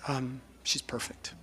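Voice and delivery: slow, fading whisper about her late mom; whispered tenderness. Retold or imitated. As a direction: let the words almost disappear. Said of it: "she's like".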